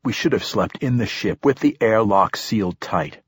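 ordinary delivery

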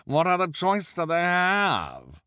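high, loud gloating voice; gloat, drawing out the final question; amused bald man. Acted. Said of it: "replied with a chuckle".

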